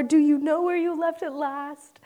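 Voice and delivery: a hint of mock patient mom; mom's question. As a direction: gently mimic a patient mother. Mom's second reply quoted.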